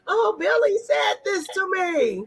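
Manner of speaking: put-on high whiny kid voice, drawn out; mock child running home to tell on Billy; imitating a tattling kid. Child tattling to parent, high whiny mock voice.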